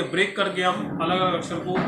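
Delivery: ordinary delivery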